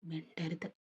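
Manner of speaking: plain reading voice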